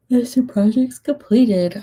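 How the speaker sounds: slow words pulled by a yawn. Real, not acted. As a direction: read it mid-yawn, slow. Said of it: "I'm yawning".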